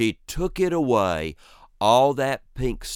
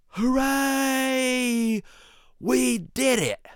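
high delighted cheer; 14 semitones higher, much slower than usual. Retold or imitated. Boys celebrating getting fired, high gleeful cheer. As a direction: crow with glee.

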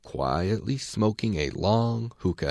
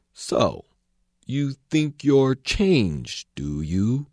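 sceptical caterpillar; 5 semitones higher, much slower than usual. A narrator's reading. Caterpillar voice, drawn out.

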